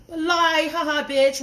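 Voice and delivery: high, loud derisive laugh; scoffing at a lie; mocking laugh. Real, not acted. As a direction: laugh off the apology scornfully. Mocking brother's apology.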